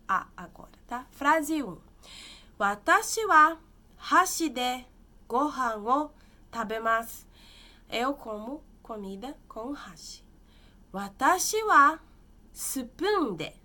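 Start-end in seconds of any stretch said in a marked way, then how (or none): 2.6-7.3 slow, loud, careful reading of the example. Shown as a demonstration. Teacher reading an example sentence to students, slow and loud. 10.9-13.5 slow, loud, careful reading of the example. Shown as a demonstration. Teacher reading an example sentence to students, slow and loud.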